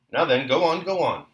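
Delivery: captain egging him on, loud and high; urging. A narrator's reading. Captain to Dólokhov, urging him on eagerly. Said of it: "incited the officer".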